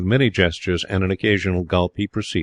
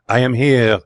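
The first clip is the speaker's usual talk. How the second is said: higher, theatrical declaration; dramatic cry; 4 semitones higher, 4 dB louder than usual. A narrator's reading. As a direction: cry it out, offering yourself up. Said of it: "Paul cried".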